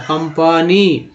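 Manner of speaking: "कंपनी" said slowly and clearly, ending in a fall; slow teacher's model word; model pronunciation. Shown as a demonstration. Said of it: "ए का उच्चारण जो अ हो रहा है".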